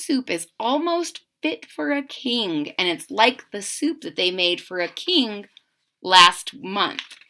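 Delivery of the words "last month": plain reading voice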